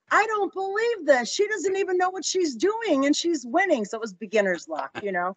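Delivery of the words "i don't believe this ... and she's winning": high, incredulous voice of a sore loser; a losing gambler's high, disbelieving whine; mock disbelief. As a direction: squeak it out in disbelief. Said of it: "who was losing said".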